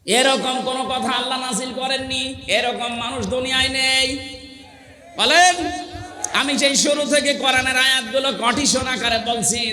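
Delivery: high, insistent sermon voice; forceful preaching. Real, not acted. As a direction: drive each point home to the crowd. (জোরালো ওয়াজের উঁচু গলা)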